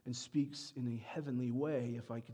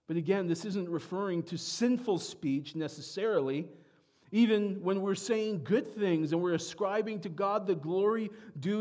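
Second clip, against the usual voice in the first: raised, louder preaching push; 6 semitones higher, 5 dB louder than usual. Acted. Pastor pressing the church on how Americans think, preaching louder. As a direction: drive the point home to the congregation. Preacher stresses earthly speech.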